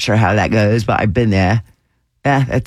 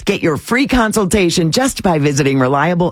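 upbeat sponsor read, often pitched higher; lively commercial pitch; radio ad read; 5 semitones higher than usual. Acted. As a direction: sell it like a friendly local radio host. Reads a sponsor ad.

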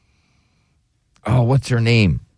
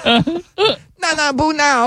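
put-on laughing accent; 13 semitones higher, much slower than usual. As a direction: giggle through the mock accent.

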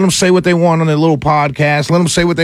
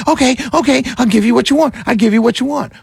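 higher, pleading give-in voice; caving parent; 10 semitones higher, faster than usual. Retold or imitated. Mocking politicians who give in to whining. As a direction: cave in like a worn-out parent. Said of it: "Some politicians capitulate".

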